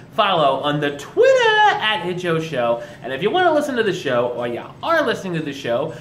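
loud upbeat show host; announcer. Acted. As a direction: hype up the episode. Show outro and plugs.

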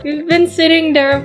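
plain reading voice

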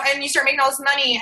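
ordinary delivery

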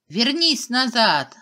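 loud scared cry, drawn out; frightened shout. A narrator's reading. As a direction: yell it out in alarm. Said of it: "закричала испуганная фея".

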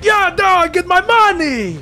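high, loud character holler; acted yelling. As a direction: yell it across a distance. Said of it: "and he's like".

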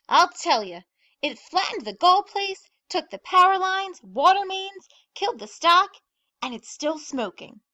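loud, high, piling up the disasters; excited gossip. A narrator's reading. Pearly telling Vera about the wreckage, loud and excited. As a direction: punch each item of the damage. Rumour grows on the phone.

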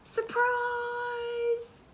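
high, loud, drawn-out surprise shout; excited cry. A narrator's reading. Mates springing a birthday surprise on Roo, excited cry. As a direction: cry it out high and long like party guests. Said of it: "Till Roo's mates all cried".

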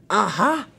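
high, loud villain's exclamation; triumphant storybook baddie; gloating villain. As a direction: pounce on a discovery. Villain Diesel spots Thomas.

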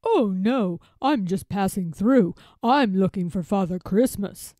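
a hint of a big elephant, projected; Babar. A narrator's reading. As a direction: answer firmly as a big friendly character. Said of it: "answers Babar".

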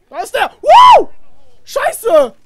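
high, loud startled yell; panicked shout. Real, not acted. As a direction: scream in sudden panic. Tank suddenly appears.

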